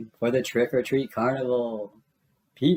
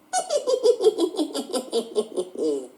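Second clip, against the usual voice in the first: light laugh; 12 semitones higher than usual. Real, not acted. Storyteller laughs.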